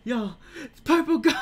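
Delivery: high shrieking yell with a laugh; excited shriek. Real, not acted. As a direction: screech it out, then laugh. Startled at a creature.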